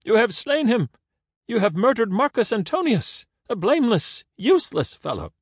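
high, loud theatrical wail; wailed protest. A narrator's reading. Caesar protesting the slaying of Marcus Antonius, high wail. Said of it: "Caesar wailed his protests".

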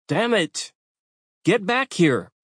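high, barked order; commanding shout. A narrator's reading. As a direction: yell the command at the fleeing raccoon. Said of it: "the Reaper commanded".

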